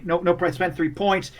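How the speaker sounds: normal talking voice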